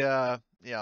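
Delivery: ordinary talk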